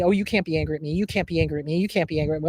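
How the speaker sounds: low, droning repetition as dad; imitated father. Dad kept telling child off, low mocking imitation. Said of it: "because he kept telling me".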